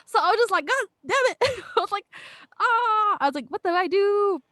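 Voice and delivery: high, loud, laughing despair; laughing exasperation. Real, not acted. Laughing at losing a roommate friendship, high and loud. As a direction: laugh through a mock wail of defeat.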